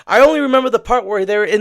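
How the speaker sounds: loud, high burst of recognition; excited. Real, not acted. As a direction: blurt it out amazed. Recognizing a clip he had seen.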